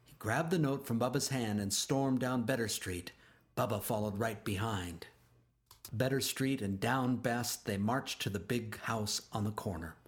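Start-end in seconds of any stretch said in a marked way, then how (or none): none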